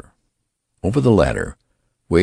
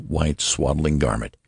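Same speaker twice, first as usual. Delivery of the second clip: usual speaking voice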